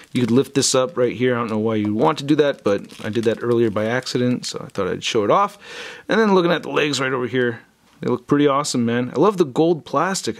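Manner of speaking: ordinary talk, nothing marked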